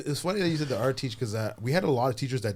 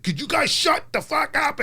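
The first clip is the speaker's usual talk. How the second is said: angry teacher; 6 dB louder, slower than usual. Retold or imitated. Shop teacher snapping, blade in hand.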